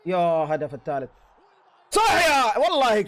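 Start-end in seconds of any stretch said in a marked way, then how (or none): 1.9-3.1 excited cheer. Real, not acted. Celebrating a third goal.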